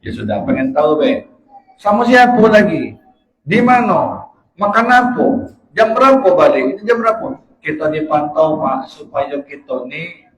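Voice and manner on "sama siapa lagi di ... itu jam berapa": high, insistent barrage of questions; a hint of a nagging wife, higher. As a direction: grill him like a suspicious wife on the phone. Nagging wife grills husband on phone.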